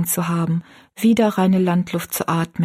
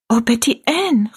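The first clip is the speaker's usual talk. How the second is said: higher, dismayed exclamation; utterly dismayed; 4 semitones higher than usual. A narrator's reading. Rosalie turns to Betty Ann over the coming wedding, utterly dismayed. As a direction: call out her name in distress. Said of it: "rief Rosalie ganz bestürzt aus".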